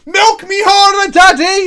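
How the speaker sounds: high, loud, drawn-out wrestler taunt; over-the-top mock battle cry; hammed-up wrestler shout. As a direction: bellow it like a showboating wrestler. Acting himself as milk-smashing wrestler.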